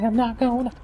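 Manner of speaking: very high, silly cartoon voice; hammed-up high squeaky hero; Ichigo. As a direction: put on an absurdly high character voice. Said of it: "That's my Ichigo impression".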